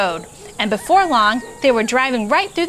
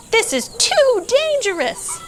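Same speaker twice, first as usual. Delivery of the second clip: mild fearful sheep; 12 semitones higher than usual. A narrator's reading. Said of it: "what she thought was".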